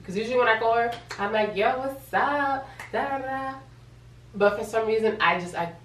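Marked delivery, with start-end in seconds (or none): 1.5-3.5 high, drawn-out phone hello; playful singsong imitation of herself; breezy greeting. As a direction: greet a cousin with easy cheer.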